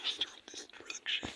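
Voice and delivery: soft, fast villain whisper; menacing whisper. Pretend. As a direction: whisper your dark title. Villain whispers his title.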